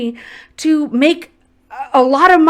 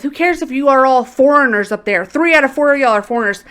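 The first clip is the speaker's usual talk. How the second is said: ordinary delivery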